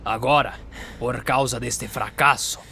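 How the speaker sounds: commanding mentor scolding recruits; stern master. Acted. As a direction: lay out the failure like a strict drill master.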